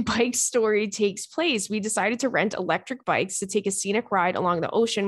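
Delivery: ordinary talk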